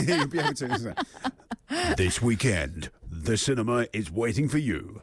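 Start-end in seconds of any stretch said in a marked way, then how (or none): 2.0-5.0 deep mock trailer voiceover; big-screen announcer parody, pitched down; movie trailer guy. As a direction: drop low and announce it like a film trailer. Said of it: "I could be the movie trailer guy".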